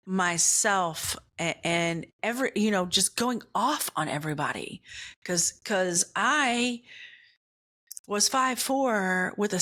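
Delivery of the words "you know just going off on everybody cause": ordinary talk, nothing marked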